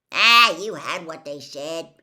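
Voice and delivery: loud, brushing-off tone; dismissive. A narrator's reading. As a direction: wave the worry away. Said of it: "said Hagrid dismissively".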